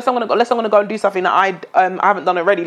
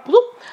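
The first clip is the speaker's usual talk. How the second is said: playful bloop; 13 semitones higher than usual. Acted. Shout out sound effect.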